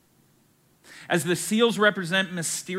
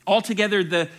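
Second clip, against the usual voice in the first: ordinary talk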